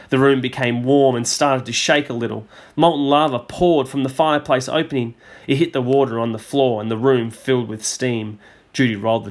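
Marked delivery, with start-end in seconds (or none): none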